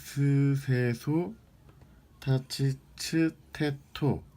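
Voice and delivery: slow syllables around something in mouth; mouth full. Shown as a demonstration. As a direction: drill with an object in mouth. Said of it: "이거를 마우스에 넣고". (입에 물고 천천히 발음)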